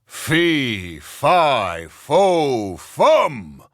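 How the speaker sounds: building, booming giant chant; giant's loud voice. A narrator's reading. The giant announces himself, a building boom. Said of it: "a loud voice said".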